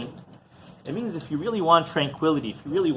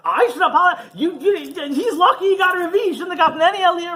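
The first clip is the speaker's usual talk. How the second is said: high, loud indignant outburst with a laugh; indignant gabbai; 4 dB louder than usual. Retold or imitated. Gabbai scoffing at the villagers' request, high indignant outburst. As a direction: scoff at the very idea of apologizing.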